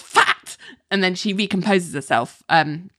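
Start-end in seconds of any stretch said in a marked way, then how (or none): 0.0-0.6 sudden high-pitched outburst; high, abrupt imitation of a yell; mock yell. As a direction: blurt it out like a retold yell. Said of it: "she just yells".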